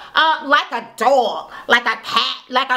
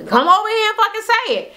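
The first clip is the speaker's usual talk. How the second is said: mock challenge; 8 semitones higher than usual. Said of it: "the twins was like".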